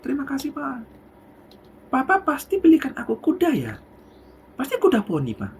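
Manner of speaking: put-on high, hopeful kid asking dad; high eager little child. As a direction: beg sweetly like a child expecting a pony. Child guessing pony gift.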